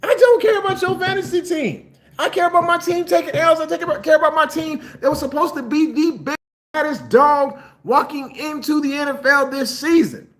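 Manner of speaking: all fired up, I don't care; frustrated rant. Real, not acted. Ranting at trash-talking fan.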